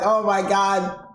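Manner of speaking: ordinary talk, nothing marked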